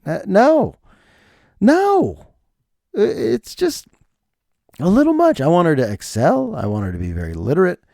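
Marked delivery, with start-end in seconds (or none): 0.3-5.4 high, squeaky protest; mock anguished refusal. Dad balking at daughter's homework, high mock anguish. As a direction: push the pitch way up as you object. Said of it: "and I'm thinking".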